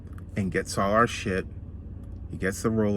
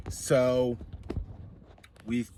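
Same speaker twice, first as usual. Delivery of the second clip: usual speaking voice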